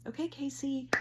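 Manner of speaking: high-pitched coaxing; coaxing dog call. Retold or imitated. Woman coaxing a sleeping dog, high-pitched call.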